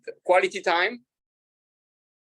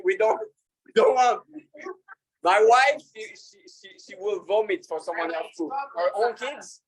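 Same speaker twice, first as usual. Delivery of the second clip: normal talking voice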